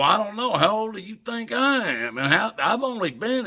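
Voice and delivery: high, loud retold comeback; animated retort. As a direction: throw the question back, high and loud. His retort to her, retold.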